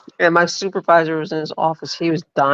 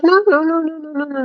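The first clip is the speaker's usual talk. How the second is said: frantic denial; 12 semitones higher than usual. Retold or imitated. Mom denying to her child, high frantic no's. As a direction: blurt denials high and fast, then fade. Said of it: "She goes".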